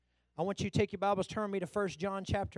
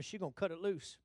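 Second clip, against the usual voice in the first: usual speaking voice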